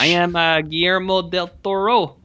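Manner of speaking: a director introduced in a jokey French Canadian accent; mock French Canadian accent. Shown as a demonstration. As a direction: announce yourself in a put-on French Canadian accent. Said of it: "so I'm gonna do French Canadian".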